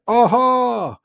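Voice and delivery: high, drawn-out exclamation; catchphrase impression. Comedian answers a fan with his catchphrase, high showy call. Acts out Coogan's catchphrase reply.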